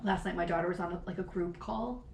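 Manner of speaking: softly, almost under the breath; hushed confiding tone; saying it quietly. Real, not acted. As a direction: keep it low so no one hears. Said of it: "I'm gonna have to say this quietly".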